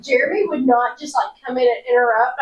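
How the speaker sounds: mildly alarmed, pitch a little up; retold panic. Coworker recounting an interrupted interview, mock-panicked. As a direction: replay the alarmed thought, lightly. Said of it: "I went into panic mode".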